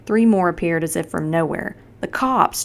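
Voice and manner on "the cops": high delighted cry. A narrator's reading. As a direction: shout for joy at rescue. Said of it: "Chet cried out in delight".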